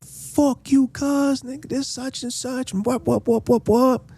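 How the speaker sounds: high, hammed-up aggressive taunting; mock him as a hothead picking a fight; imitated street taunt. Juan Lo squaring up to the big dude, imitated high taunting. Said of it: "he's like".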